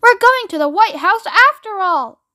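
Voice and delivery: high, lilting jubilant cry; excited grandpa crowing with joy; gleeful singsong. A narrator's reading. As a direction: sing it out in celebration. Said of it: "sang Grandpa Joe".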